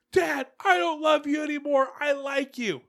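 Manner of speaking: put-on high little-girl voice; imitating little daughter. Dad quoting his six-year-old daughter, high child voice.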